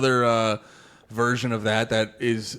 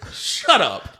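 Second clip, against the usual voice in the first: mock rude voice; 5 semitones higher, 4 dB louder than usual. Mocking [name], shouted.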